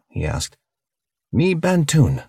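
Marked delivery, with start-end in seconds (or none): 1.3-2.2 high-pitched, proud creature announcement; proud alien. A narrator's reading. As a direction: announce your species with pride, pitched well up. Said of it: "proudly thumping his chest".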